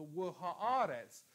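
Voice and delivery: wahaaretz read slowly with a long aa; slow Hebrew word again. Shown as a demonstration. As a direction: repeat wahaaretz slowly for the class. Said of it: "stesso punto". (wahaaretz ripetuto lentamente)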